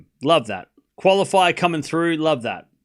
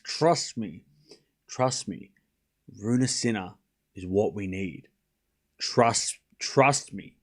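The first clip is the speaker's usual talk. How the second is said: low, soft and earnest; hushed insistence; 7 semitones lower, 7 dB quieter, slower than usual. Real, not acted. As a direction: press the point quietly, with pauses. Solemnly persuading the chat.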